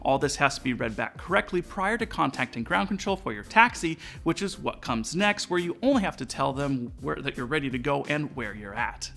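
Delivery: ordinary delivery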